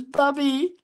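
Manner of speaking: high, drawn-out imitation of a giggly child; playful high-pitched small-kid voice; laughing little grandson. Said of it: "he's laughing. He goes".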